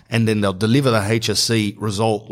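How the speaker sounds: ordinary delivery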